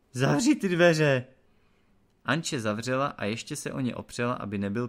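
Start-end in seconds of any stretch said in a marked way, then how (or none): 0.1-1.3 loud, high, slow bellow; shouted order. A narrator's reading. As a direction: yell it between sneezes. Sneezing lord shouts orders.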